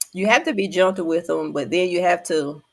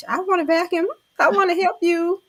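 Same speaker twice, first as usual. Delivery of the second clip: put-on high little-child plea; three-year-old grandbaby; 13 semitones higher than usual. Grandmother mimics grandbaby asking to help, high toddler voice. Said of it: "my little grandbaby, she three".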